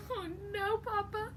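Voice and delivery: high whimpering plea; whiny chicken. Acted. Chicken begging papa for a story, high and whiny. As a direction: whine like a chick who can't sleep. Chicken distressed, cry heard.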